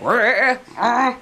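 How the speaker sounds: yarl it like a wild Aussie; Australian yarl. Retold or imitated. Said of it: "but in an Australian accent".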